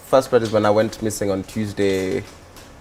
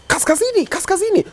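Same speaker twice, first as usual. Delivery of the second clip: very high-pitched mock yell; imitated shout. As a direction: mimic someone crying out, pitch leaping up. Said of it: "she shouts".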